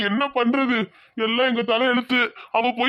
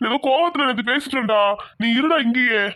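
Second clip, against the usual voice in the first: ordinary talk, nothing marked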